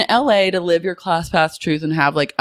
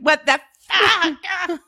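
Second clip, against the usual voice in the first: high, slow outraged squeal; irate Jessica; 13 semitones higher, much slower than usual. Pretend. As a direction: drag it out in shrill disbelief.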